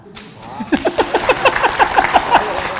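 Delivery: high, loud rolling cackle; long high laugh. Real, not acted. Speaker amused before telling another story, long high laugh.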